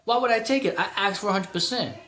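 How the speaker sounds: retold indignant protest; indignant retort. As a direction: push back, incredulous. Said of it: "I was like".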